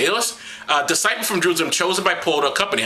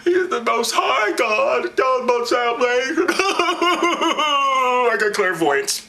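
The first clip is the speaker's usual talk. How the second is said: high, slow proclamation; crying-out possessed girl; 6 semitones higher, slower than usual. Acted. Possessed girl cries out after Paul and Silas, high. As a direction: call it after them like an oracle.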